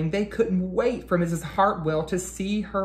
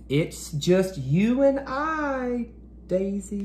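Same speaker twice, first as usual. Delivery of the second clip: slow, higher voice of a lonely teacher; lightly voiced teacher; 5 semitones higher, slower than usual. Teacher alone, voiced character.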